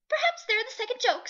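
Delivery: high, loud cheeky bird; a cheeky bird quipping; jackdaw voice. A narrator's reading. The jackdaw joking about the humans, high and cheeky.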